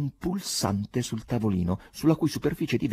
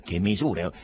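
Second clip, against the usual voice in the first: usual speaking voice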